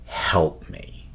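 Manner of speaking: breathy hushed help me; mock eerie plea. Describing the bear scene, a hushed, unvoiced eerie plea. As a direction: whisper it like a dying voice. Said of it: "It screams in this woman's voice".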